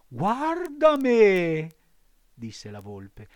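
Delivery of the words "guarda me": high, loud, slow fox voice; a hammed-up warning; sly fox. A narrator's reading. Fox warns against studying.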